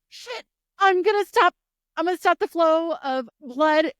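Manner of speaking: high, loud emergency voice; acted alarm at a wound; mock panic. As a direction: panic loudly as you scramble to help. Acting panic at a stabbing.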